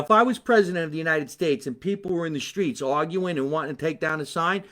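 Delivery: ordinary talk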